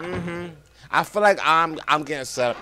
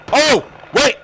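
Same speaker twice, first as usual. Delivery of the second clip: high, loud yelp; sudden alarmed shout; startled yell; 10 semitones higher, 9 dB louder than usual. Real, not acted. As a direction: cry out as you're caught off guard. Sudden attack in the game.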